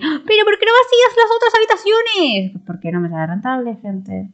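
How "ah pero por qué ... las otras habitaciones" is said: high, mocking whiny voice; mocking quote. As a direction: mimic a nagging viewer. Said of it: "Que se me van a decir".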